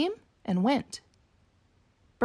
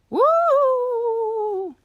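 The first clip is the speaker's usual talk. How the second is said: excited whoop; 11 semitones higher, 5 dB louder than usual. Acted. Fun with friends.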